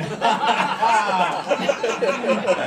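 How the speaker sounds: long rolling laugh. Real, not acted. Laughing at the conductor's answer. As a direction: crack up at the punchline.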